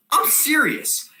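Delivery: high, indignant exclamation; emphatic insistence. Acted. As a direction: insist you are not joking. Insists to audience on absurd fact.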